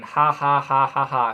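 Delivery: deliberate ha ha ha, spoken not real; a dry, put-on laugh; spelled-out laugh. Amused at his own donkey joke, a mock laugh. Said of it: "Hence why I'm laughing".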